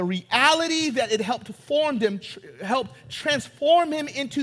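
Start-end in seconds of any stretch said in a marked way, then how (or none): none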